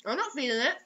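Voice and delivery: ordinary talk, nothing marked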